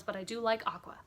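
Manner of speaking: normal talking voice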